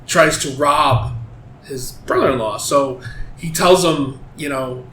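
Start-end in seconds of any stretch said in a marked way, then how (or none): none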